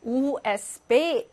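USB spelled in German, "우에스비"; slow German reading of U, S, B; German letter names. Shown as a demonstration. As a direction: say "우에스비" slowly, U as "우". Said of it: "유에스비는 어떻게 읽어?".

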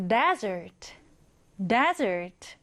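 "desert" stressed hard on DE, second syllable light; stress on the front. Shown as a demonstration. Teacher models "desert" for students, stress on the front. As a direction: punch the DE of "desert". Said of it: "강세는 앞에 강하게 주면 됩니다".

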